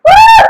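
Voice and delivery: high whooping cheer. Real, not acted. Coach cheers the ladies through the plank with a high whoop. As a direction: whoop to pump up the class.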